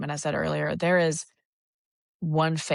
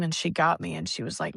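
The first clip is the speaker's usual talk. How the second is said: ordinary talk, nothing marked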